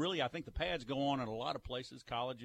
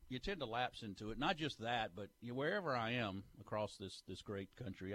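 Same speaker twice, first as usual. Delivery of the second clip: ordinary talk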